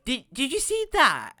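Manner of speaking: high, swooping excited cartoon voice; wrong character voice. Kid monster asking about what he saw, in a high cartoon voice. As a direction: slip into a high, exaggerated character voice. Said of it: "why do I have a Paris accent".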